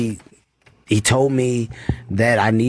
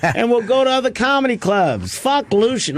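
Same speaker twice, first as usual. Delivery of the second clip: much higher mock friend voice, breezy and dismissive; imitating Keith; 11 semitones higher than usual. Keith brushes off the young comic's rejection, high mock voice. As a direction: toss it off like nothing matters, pitched way up. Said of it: "And Keith was like".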